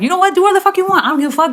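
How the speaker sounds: high-pitched, fed-up rant; exasperated outburst. Retold or imitated. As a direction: let the voice jump up high in exasperation. Acted outburst at the hairdresser.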